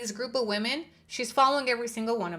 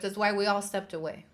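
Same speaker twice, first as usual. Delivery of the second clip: ordinary talk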